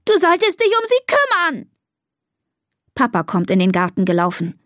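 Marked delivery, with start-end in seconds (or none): 0.0-1.7 high-pitched, foot-stamping reproach; angry scream. A narrator's reading. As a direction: scold shrilly through the upset. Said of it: "schreit Elisa und stampft".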